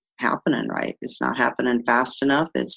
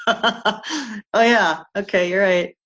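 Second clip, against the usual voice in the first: laughing, higher-pitched admission; 7 semitones higher, slower than usual. Retold or imitated. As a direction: laugh while conceding the point. Laughing at own retold thoughts.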